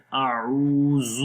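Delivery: "اعوذ" chanted slowly with a soft ending ذ; slow recitation of a'udhu; soft zaal recited. Shown as a demonstration. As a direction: recite "اعوذ" long and gentle. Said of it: "اس کو سخت نہیں پڑھیں گے".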